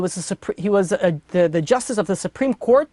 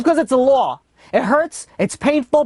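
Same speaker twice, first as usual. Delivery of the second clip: high, louder preaching swell; impassioned preaching; 6 semitones higher, 3 dB louder, slower than usual. Acted. Rabbi to grieving judge, impassioned higher preaching. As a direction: lift it up and preach it.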